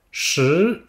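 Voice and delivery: "Shi" with a small E, rising from mid to high. Shown as a demonstration. Teacher models "Shi" for students: rising tone. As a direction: lift "Shi" upward, from the middle to the top. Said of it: "on monte vers l'aigu".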